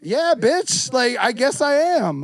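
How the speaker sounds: high, emphatic retort. Acted. Answering the roommate who objects to yelling, high emphatic comeback. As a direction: talk back firmly, short of a yell. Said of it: "that's not even me yelling".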